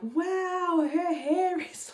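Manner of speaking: high, drawn-out wonder; amazed. Retold or imitated. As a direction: marvel at what you see. Emily amazed at baby's hair.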